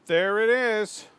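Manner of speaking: slow, high and loud triumphant call; triumphant shout. Real, not acted. Gamer finding the pickaxe location, slow loud triumphant shout. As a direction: stretch it out like you struck gold.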